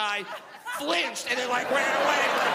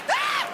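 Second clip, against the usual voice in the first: startled scream; 6 dB louder than usual. Retold or imitated. Startled man at the sight.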